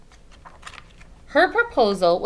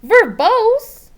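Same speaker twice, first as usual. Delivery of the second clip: high, loud puzzled question; puzzled child; 14 semitones higher, 13 dB louder than usual. Acted. Little girl puzzled by her mother's big word, high and loud. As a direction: squeak the strange word back in surprise.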